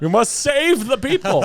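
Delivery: high, loud hammed-up zealot; mock zealot. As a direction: proclaim it like a cult leader. Mock cult mission, louder.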